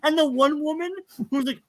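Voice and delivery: ordinary talk, nothing marked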